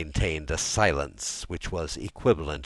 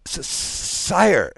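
high, loud stammered address; nervous stuttering baron; stammering; 13 semitones higher, 8 dB louder than usual. A narrator's reading. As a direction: stumble on the first sound, then burst out. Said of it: "stammered the Baron".

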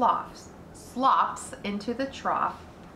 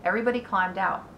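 ordinary delivery

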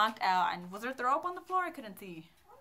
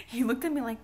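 ordinary delivery

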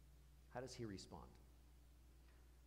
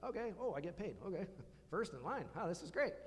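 mock delight; 5 semitones higher, faster than usual. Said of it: "they're like".

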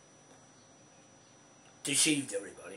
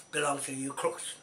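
ordinary talk

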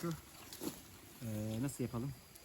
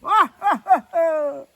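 loud yelps; 9 semitones higher, 14 dB louder, much slower than usual. Real, not acted. Reacting to the fire.

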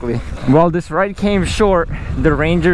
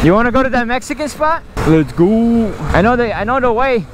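high eager invitation; excited; 9 semitones higher than usual. Real, not acted. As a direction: pump yourself up about food. Excited about the Mexican spot.

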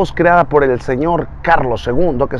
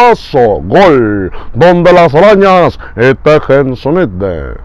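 Perro Bermúdez voice. Retold or imitated. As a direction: stretch the goal call like a sportscaster. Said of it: "la voz del perro Bermúdez". (narrador de fútbol cantando el gol)

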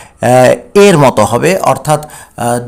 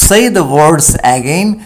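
drawn out for learners; slow classroom model; slow model reading; much slower than usual. Shown as a demonstration. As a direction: say the words again, read very slowly.

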